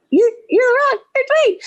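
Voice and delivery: high, loud retold fan squeal; re-enacted fan meeting an idol; starstruck. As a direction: blurt it out, stammering with excitement.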